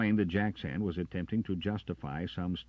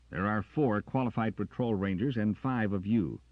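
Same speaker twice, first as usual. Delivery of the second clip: louder, unyielding reply; stubborn; 6 semitones higher, 7 dB louder than usual. A narrator's reading. Carter answering Jackson, stubborn and louder. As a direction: dig in stubbornly. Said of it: "he returned stubbornly".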